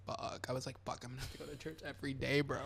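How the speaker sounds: very soft, low-volume retold panic; hushed dismay. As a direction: mutter it under your breath as a dreading kid. Child's dismayed inner reaction, retold.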